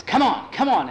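high loud urging; high desperate urging shout. Acted. Urging horse in race.

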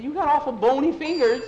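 high, loud remark; nervous, frightened. Acted. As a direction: say it with rising panic. Scared boy feels bony fingers.

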